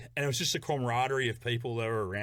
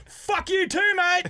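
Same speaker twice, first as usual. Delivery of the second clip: high, loud hollered comeback; retold shout back; 7 dB louder, much slower than usual. Him returning the fan's heckle, shouted. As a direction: yell it back across the distance.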